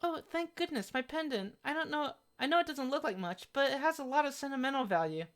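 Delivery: high-pitched, polite game heroine; sweet girl character. Acted. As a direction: voice a relieved, pleading girl.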